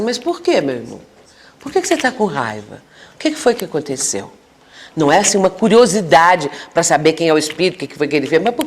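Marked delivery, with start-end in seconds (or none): 0.0-4.3 softer, caring questions to a troubled spirit; gentle questioning. Shown as a demonstration. Counselor gently asking an angry spirit why, softer and tender. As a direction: ask softly, like you truly want to know. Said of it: "por que que o doutrinador não fala assim".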